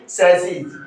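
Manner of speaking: सेसीज said "SAS-eez"; plural pronunciation. Shown as a demonstration. Teacher showing the plural to students, pronounced "sas-eez". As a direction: say सेसीज with a final z or j sound. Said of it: "क्या बोलेंगे".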